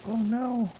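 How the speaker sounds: high, loud mock dismay; hammed-up gasp of horror; mock alarm.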